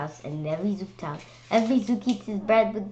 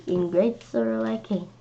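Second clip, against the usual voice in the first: ordinary delivery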